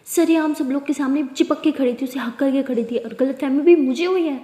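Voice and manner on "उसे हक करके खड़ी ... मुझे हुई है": usual speaking voice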